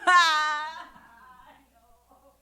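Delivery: high, very slow mock dismay; a drawn-out panicked gasp of despair; hammed-up alarm; dismayed cry. Realizes she knows no numbers.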